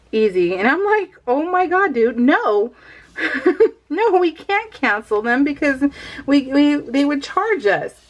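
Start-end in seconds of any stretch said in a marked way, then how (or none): none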